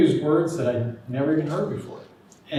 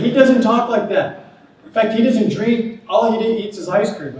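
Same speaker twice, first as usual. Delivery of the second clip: high, loud, laughing through the words; can barely get it out for laughing; laughing retelling; 10 semitones higher, 10 dB louder than usual. Retold or imitated. Boss recounting what he told the rude man, laughing hard.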